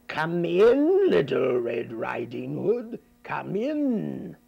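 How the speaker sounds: wolf hamming up a sweet granny; wolf as grandmother. Acted. Wolf in bed pretending to be grandmother, lightly faked. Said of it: "who was now grandmother, said".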